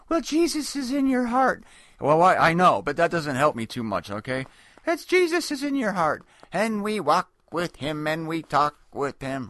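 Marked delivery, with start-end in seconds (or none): 0.1-1.6 high, sugary churchy voice; mock pious answer. Mocking a pious stock answer. 4.9-9.5 mock pious answer. Mocking a pious stock answer.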